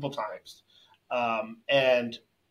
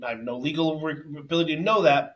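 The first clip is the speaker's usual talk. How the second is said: normal talking voice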